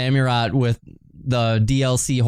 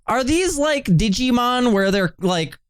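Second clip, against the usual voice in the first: high excited; 9 semitones higher than usual. Real, not acted.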